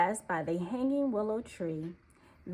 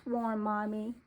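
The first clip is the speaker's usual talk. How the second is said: usual speaking voice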